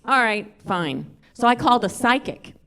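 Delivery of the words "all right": usual speaking voice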